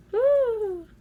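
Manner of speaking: high whoop of delight; a high excited woo. Retold or imitated. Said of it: "I'm all".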